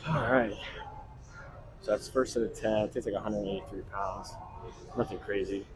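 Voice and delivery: winded after a heavy set; out of breath, catching your breath between words. Real, not acted. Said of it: "Out of breath, though".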